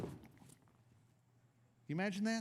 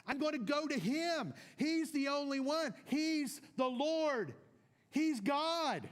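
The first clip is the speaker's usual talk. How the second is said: preaching fervor; 5 semitones higher than usual. Real, not acted. Preaching climax.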